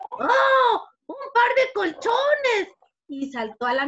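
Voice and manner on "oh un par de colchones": high, loud gasp of wonder, words stretched out; delighted discovery. Acted. Fly spots two cheeks as mattresses, thrilled. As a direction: exclaim like an amazed little fly. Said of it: "miró al otro y dijo".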